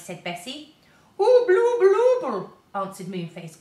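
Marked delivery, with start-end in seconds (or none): 1.2-2.5 high, loud, slow gummed-up mumble; mouth full of toffee. A narrator's reading. Moonface answering with his teeth stuck in toffee. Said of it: "quite unable to speak properly".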